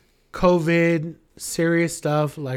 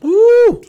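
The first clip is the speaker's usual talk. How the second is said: cheering woo; 9 dB louder than usual. Real, not acted. Cheer after the toast.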